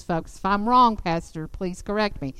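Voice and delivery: ordinary talk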